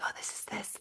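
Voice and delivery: very soft, voiceless imitation of whispering. Whispering to a partner during the movie, hushed whisper. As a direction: whisper it as if to a seatmate. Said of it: "like, whisper to each other".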